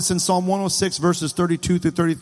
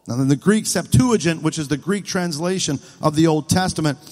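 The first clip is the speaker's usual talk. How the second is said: ordinary delivery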